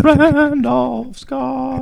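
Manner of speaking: squeaky drawn-out announcement; high slow drawn-out name. Pretend. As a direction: stretch it high like a jingle. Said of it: "Anytime we mention him, should we do".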